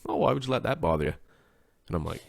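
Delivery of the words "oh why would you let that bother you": high, quick breezy imitation; carefree coworker. Coworker brushing off anger, high breezy voice. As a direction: toss it off light and easy. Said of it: "he'd be like".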